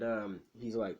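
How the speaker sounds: usual speaking voice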